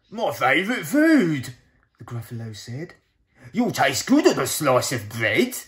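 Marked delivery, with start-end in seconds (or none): 0.1-1.5 louder, booming monster voice; Gruffalo voice. Acted. Gruffalo eyes the mouse as food, booming. 3.5-5.6 louder, booming monster voice; Gruffalo voice. Acted. Gruffalo eyes the mouse as food, booming.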